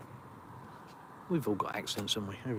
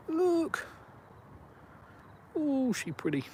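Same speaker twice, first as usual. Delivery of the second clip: much higher, sing-song, cutesy; slower than usual. Real, not acted. Admiring the moon for his viewers, high cooing baby talk.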